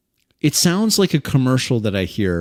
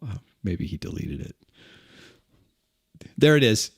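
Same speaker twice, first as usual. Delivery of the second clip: ordinary talk, nothing marked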